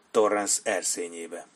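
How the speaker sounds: plain reading voice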